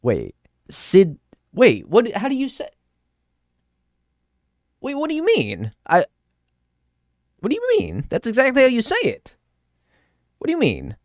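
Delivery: high-pitched, incredulous questioning; baffled disbelief. Real, not acted. Streamer baffled by chat over saying Sydney, high incredulous protest. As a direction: protest in a squeaky, confused high voice.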